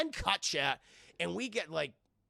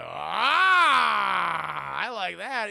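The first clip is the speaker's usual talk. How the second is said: Deathstroke voice; 4 semitones higher, 11 dB louder, much slower than usual. Retold or imitated.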